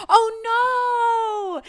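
high, loud hammed-up cry of "oh no"; mock horrified wail. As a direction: shriek it like a fake panic. Mock horror reading reply.

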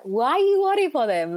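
high, loud put-on of mum's accented English. Telling husband not to worry, in mum's accent. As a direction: channel mum's accent at full volume. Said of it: "I still say it now to my husband in that accent".

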